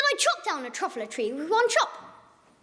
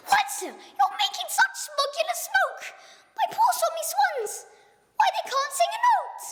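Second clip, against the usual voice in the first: crying Lorax; 13 semitones higher than usual. Acted. Lorax lamenting the smog to the Once-ler, high upset cry. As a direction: wail over the poor swans. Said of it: "he cried with a crofulous croak".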